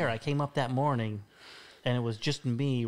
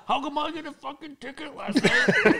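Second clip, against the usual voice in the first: high, louder mock outrage through laughter; mock indignant; 5 dB louder than usual. Retelling Ron griping about his ticket, laughing mock outrage. Angry dad quoted, laughing.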